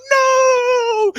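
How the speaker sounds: very high, loud mock-despair "No!"; reading game with kids, a hammed-up anguished shout; dramatic shout. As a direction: wail it like the story's ruined. Game timer runs out.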